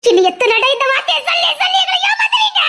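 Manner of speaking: high-pitched, furious yelling; accusing shout. Acted. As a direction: scream the accusation at him. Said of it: "கத்தாதடி".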